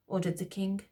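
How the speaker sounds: plain reading voice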